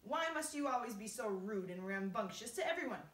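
loud indignant scolding; cross cry. A narrator's reading. Earthworm scolding the centipede for rudeness, loud indignation. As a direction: scold at full voice, easing slightly at the end. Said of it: "cried the earthworm".

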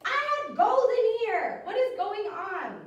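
high, loud, drawn-out mock dismay; mock shock. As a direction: pretend the treasure is gone. Pretend empty pirate chest.

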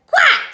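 very high, loud quack; duck quack. A narrator's reading. Duck hears of the plot, loud high quacking. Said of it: "said the duck".

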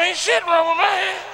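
a touch of huffy denial; defensive retort. Retold or imitated. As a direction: snap back like you've been accused. Defensive head-shaking retort.

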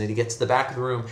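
ordinary delivery

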